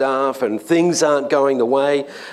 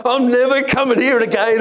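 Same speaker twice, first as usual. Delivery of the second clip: high, louder fed-up outburst; retold frustration; 12 semitones higher, 4 dB louder than usual. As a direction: vent at the car wash that won't take coins. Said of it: "just ticked and upset and frustrated".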